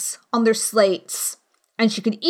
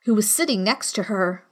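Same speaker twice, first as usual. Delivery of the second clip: plain reading voice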